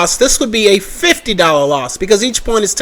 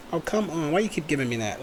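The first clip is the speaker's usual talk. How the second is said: much softer grumble at the screen; frustrated mutter; 5 semitones lower, 17 dB quieter, faster than usual. Real, not acted. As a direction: complain quietly to the program. Frustrated with software again.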